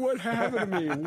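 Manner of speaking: high, loud mock bewilderment through laughter; imitating a baffled actor. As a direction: wail it in comic disbelief, laughing. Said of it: "He's going".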